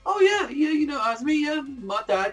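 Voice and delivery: high goofy voice as Soma; mock Soma. Reviewer voicing Soma telling Azumi his dad. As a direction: play Soma grinning and dodging. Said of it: "Soma's like".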